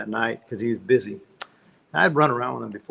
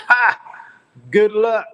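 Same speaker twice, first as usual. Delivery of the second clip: much higher and louder mocking laugh; scoffing laugh; 9 semitones higher, 8 dB louder, slower than usual. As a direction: laugh it off loudly, imitating Richie. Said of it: "And he said".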